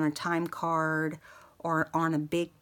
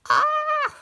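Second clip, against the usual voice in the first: high delighted squeal of joy; excited squeal; 9 dB louder than usual. Real, not acted. Delighted gasp at fabric.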